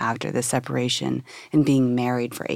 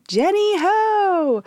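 excited greeting; much slower than usual. Retold or imitated. Old classmate calling her name.

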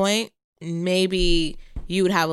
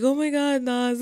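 higher, put-on voice for the husband; a husband's startled cry, pitched up; husband's surprise; 7 semitones higher than usual. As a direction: imitate him caught off guard. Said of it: "And he's like".

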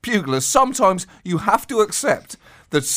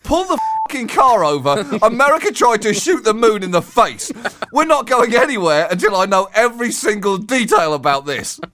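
louder and higher, hammed-up insistence; mock urgent demand; 6 semitones higher, 3 dB louder than usual. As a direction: demand it as if the car must stop now. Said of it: "That is something where you say".

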